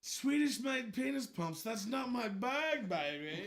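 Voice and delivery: slow Austin Powers drawl; lightly voiced groovy British spy. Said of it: "he was British, baby".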